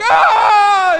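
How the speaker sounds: sudden loud high yelp of shock; jolted awake with a cry of pain; startled yelp. Retold or imitated. Son woken by his dad lancing the boil, startled yelp.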